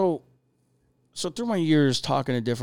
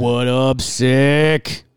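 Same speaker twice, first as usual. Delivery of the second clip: louder hype shout-out; calling out greeting; 10 dB louder, much slower than usual. Real, not acted. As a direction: call out to Sick joining the chat, loud and happy. Greeting artist joining the chat.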